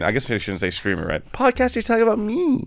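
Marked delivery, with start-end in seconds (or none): 1.3-2.7 mock excited fan. Mock fan voice, self-centered.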